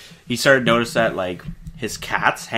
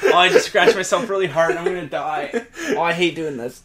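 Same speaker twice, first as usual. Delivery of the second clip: higher, hammed-up dying wail, laughing; bad dramatic acting; 6 semitones higher than usual. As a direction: overact a melodramatic death. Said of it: "very bad dramatic acting".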